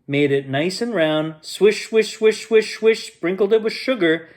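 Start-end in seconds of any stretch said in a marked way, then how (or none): none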